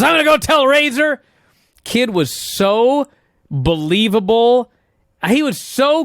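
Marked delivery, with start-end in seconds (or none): 0.0-1.2 excited mock announcement. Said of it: "Bobby goes".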